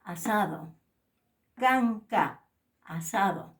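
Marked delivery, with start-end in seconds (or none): 1.6-2.4 "canca" said loudly with a plain k; plain k model. Shown as a demonstration. Teacher modelling the plain k. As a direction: model "canca" slowly for the class. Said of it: "La K se pronuncia canca".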